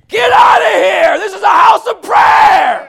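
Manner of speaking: furious, slow, booming command; angry Jesus shouting. Acted. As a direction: drive them out of the temple yelling. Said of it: "He went into the temple and said".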